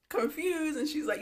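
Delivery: normal talking voice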